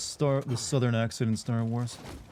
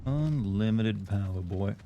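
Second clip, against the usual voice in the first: put-on Southern drawl with boy; 2 semitones lower, slower than usual. Shown as a demonstration. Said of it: "you gotta throw a boy on there".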